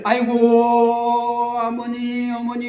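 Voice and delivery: drawn-out mock wail of grief; keen like a mourner at a funeral; imitating a funeral lament; mourner's wail. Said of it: "곡을 할 거 아니에요".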